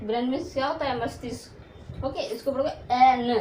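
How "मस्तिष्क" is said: soft aside. Real, not acted. Aside gloss.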